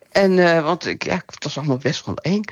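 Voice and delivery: ordinary talk, nothing marked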